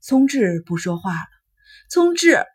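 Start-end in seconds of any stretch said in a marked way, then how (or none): 1.9-2.4 loud high cry of his name; calling out. A narrator's reading. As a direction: call his name sharply, much higher. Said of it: "优希喊道".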